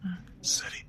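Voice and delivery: whisper. Acted. Tender moment.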